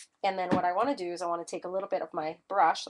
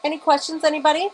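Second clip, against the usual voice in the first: higher, louder call to the room; 7 semitones higher, 9 dB louder, faster than usual. Real, not acted. Host calls out to viewers for questions, louder. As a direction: throw it out, hoping for hands.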